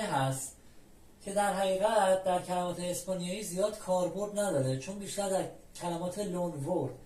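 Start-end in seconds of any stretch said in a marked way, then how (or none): none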